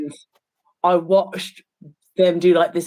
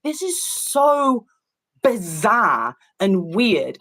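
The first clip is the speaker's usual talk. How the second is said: drawn-out disbelief; 2 semitones higher, much slower than usual. Retold or imitated. Retold reaction, stretched words.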